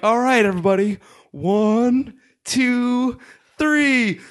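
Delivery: imitating a teacher rallying everybody; teacher's count-in. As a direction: count off with rising pitch, building to a chant. Teacher counts in group chant.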